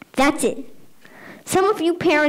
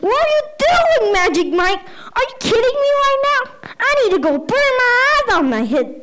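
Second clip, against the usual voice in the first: high-pitched, hammed-up outrage; scandalized teacher losing it, played for laughs; appalled outburst; 10 semitones higher than usual. Teacher appalled by dancing kid, acted.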